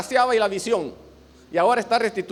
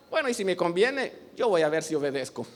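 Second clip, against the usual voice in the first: reluctant obedience; 5 semitones lower, 5 dB quieter than usual. Acted. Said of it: "No decir".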